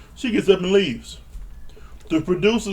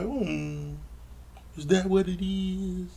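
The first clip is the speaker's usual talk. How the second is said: soft, higher put-on coo; mock gullible; 4 semitones higher, 9 dB quieter, slower than usual. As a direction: imitate her falling for it, softly. Acting out Paige's gullible reply.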